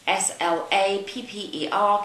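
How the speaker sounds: spelling it letter by letter; spelled out. Shown as a demonstration.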